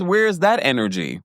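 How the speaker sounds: ordinary talk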